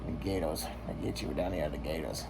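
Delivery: deep, hushed New Orleans voice; New Orleans accent. Shown as a demonstration. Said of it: "say it like you're from New Orleans".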